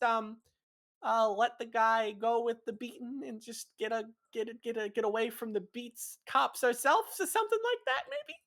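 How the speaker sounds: high, stumbling suggestion; hesitant Hap. Acted. Hap talking Hugh out of killing, hesitant. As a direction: feel your way through a timid plan.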